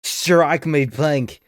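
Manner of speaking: a playful Australian catchphrase, dragged out; mock Australian accent. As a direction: stretch every word of "Strike me pink" in a hammed-up Aussie twang. Repeats the catchphrase in character.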